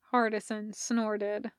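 usual speaking voice

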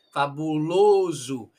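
slow "fabuloso", ending in -zu; O gliding to U. Shown as a demonstration. Teacher demonstrating "fabuloso" with the O moving to U. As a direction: draw out "fabuloso", final O turned to U. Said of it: "un O ya caminando para el U".

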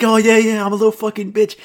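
high, eager dog voice, agreeing; excited pet going along with it; happy dog. Acted. Voicing a happily fooled dog.

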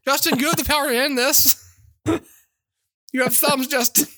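high, thin mock entreaty; hammed-up victim begging; pleading fish user. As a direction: implore him in a tiny high voice. Swallowed fish begs Justin.

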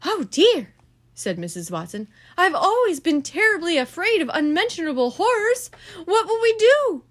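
high, loud fretting; hammed-up panic; mock fright. A narrator's reading.

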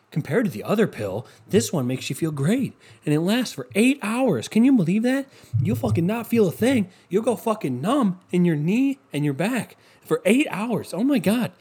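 high, hyped-up pitchman voice; mock pill commercial. Mocking a pain pill ad, high hyped voice. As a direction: oversell the pill like a cheesy ad. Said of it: "And it's like".

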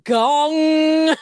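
loud sustained gong call; loud gong imitation. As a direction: boom out a gong sound. Mimics a gong.

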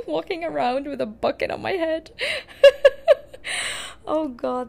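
high, loud laughter bursting through the words; laughing hard. Real, not acted. Laughing at herself with a bucket on her head. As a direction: crack up at the silly picture.

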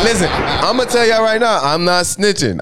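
high, insistent mock-defensive protest; worked-up, high-pitched denial; playful protest. Real, not acted. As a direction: raise the pitch and insist over the teasing. Protests amid teasing about snitching.

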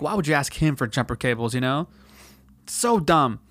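ordinary talk

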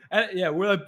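ordinary delivery

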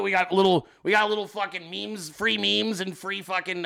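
usual speaking voice

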